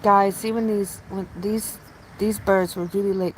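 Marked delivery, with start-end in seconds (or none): none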